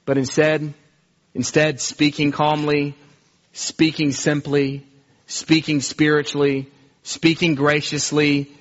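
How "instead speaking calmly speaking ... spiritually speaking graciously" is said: ordinary talk, nothing marked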